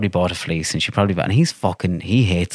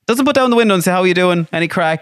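indignant mock; 10 semitones higher than usual. Real, not acted. Mocking the man's silence.

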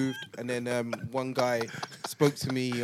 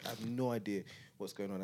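normal talking voice

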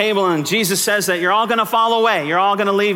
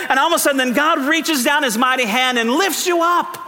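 preaching at full force; 8 semitones higher than usual. Acted. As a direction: proclaim it with rising fire. Preaching climax.

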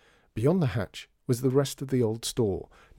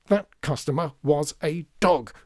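slow, louder, hammering out each word; indignant raised voice building to the last word; angry shouting; 5 semitones higher, 5 dB louder, slower than usual. A narrator's reading. Veruza correcting John in a kitchen row, shouting. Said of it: "Veruza was shouting now".

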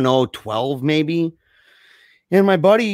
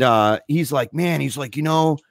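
ordinary talk

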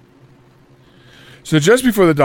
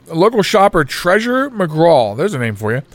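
normal talking voice

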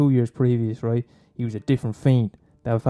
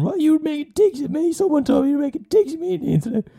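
much higher put-on girl voice, accusing; offended girl. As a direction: confront him in a high girly voice. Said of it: "any girl texting me going".

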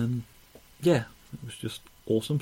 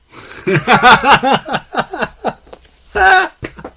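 loud burst of laughter; 16 dB louder than usual. Real, not acted. Huge laugh after "Wankers.

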